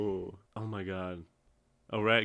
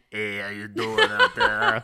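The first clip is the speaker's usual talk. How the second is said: slow, louder laughing rat greeting; lightly voiced rat greeting the podcast listeners; pizza rat voice; 7 dB louder than usual. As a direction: play the tough rat saying hi. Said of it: "It's the pizza rat".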